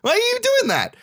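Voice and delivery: very high squeaky disbelief; acted-out incredulous protest; mock outrage. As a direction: shoot your pitch up in exaggerated bafflement. Reacting in disbelief to the dad.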